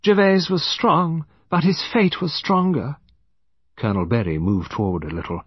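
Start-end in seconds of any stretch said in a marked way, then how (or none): 0.0-3.0 high-pitched lady's voice; Lady Chevenix Gore. A narrator's reading. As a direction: pitch it high, pronouncing on fate. Lady Chevenix Gore speaks of fate.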